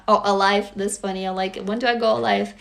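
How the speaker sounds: ordinary talk, nothing marked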